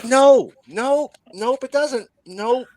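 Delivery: high, insistent no, nope; emphatic denial. Real, not acted. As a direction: protest it, pitch way up. Denying loudly, high pitched.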